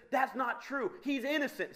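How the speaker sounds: lightly acted denial; mock indignant mother. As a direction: play a mother defending her child. Mother defends her guilty son.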